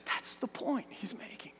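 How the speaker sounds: soft whispered aside. Acted. Preacher confiding the point to the congregation in a whisper. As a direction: breathe it like a secret. Aside after pressing question.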